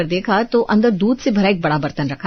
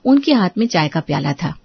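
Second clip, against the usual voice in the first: ordinary delivery, nothing marked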